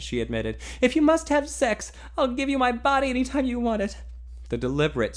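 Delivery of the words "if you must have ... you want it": put-on higher, louder voice of a young woman; heroine's voice. A narrator's reading. Heroine pleading with the man she loves, higher and louder.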